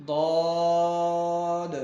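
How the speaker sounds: ضاد loud, long, with rounded vowel; stretched ضاد. Shown as a demonstration. Teacher models correct reading, ضاد long and full. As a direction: chant ضاد slowly, bouncing final D. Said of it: "تو کیا بنا".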